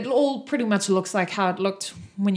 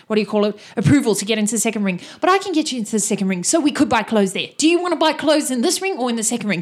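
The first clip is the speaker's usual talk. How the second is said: fast, loud eager rambling; fast chatty guide; eager NPC; 3 dB louder, much faster than usual. Acted. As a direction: rush through it helpfully.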